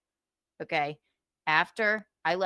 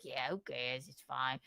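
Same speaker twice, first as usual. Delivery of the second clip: low, much softer dismissive mumble; mock shrug; 7 semitones lower, 6 dB quieter than usual. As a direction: brush it off, quiet and low. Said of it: "he's like".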